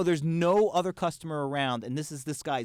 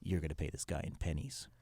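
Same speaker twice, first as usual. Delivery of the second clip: much softer, lower, a conspiratorial murmur; hushed aside; 9 semitones lower, 10 dB quieter than usual. Retold or imitated. Leaning down to tell son Luke a secret, hushed. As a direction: lean in and keep it secret. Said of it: "I lean down to Luke and I go".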